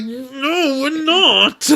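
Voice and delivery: high, loud drawn-out refusal; mock refusal. Voices Koki refusing friendship.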